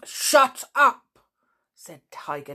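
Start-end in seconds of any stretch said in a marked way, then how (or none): 0.0-1.0 loud fierce bark, higher. A narrator's reading. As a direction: cut the boy off savagely. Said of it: "in such a fierce voice".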